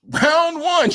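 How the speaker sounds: high-pitched mock ring announcer; fight announcer. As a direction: call the round like a fight game.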